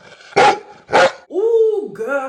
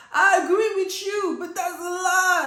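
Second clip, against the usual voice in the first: retold quote; 5 semitones higher than usual. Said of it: "and I'm like".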